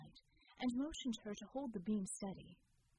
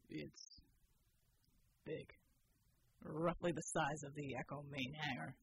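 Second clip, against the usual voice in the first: low, hushed at first, grim; low grim soldier; 7 semitones lower than usual. A narrator's reading. Bull's low voice.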